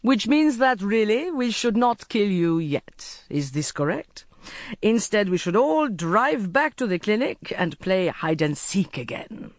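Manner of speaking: lightly voiced sheikh, lively pitch; amused sheikh. Sheikh toying with his captive, mildly amused. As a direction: play a lightly amused captor.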